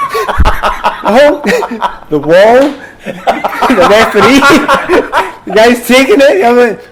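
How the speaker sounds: laughing hard through the words, high and loud; can barely get the story out for laughing. Real, not acted. Retelling the wall story to the host, laughing hard.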